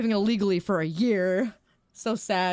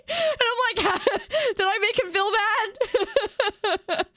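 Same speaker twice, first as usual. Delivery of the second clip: laughing; 12 semitones higher than usual. Real, not acted. Laughing while retelling own thought.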